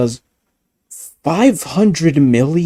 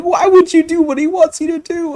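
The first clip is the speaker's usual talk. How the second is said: high squeaky outrage; 3 dB louder than usual. Retold or imitated. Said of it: "I'm like".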